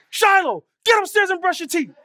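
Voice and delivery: loud, high retold yell at his son; dad finally snaps at his toddler, yelling loud and high; lost it, yelling. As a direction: bellow the name, then the order. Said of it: "I lost it".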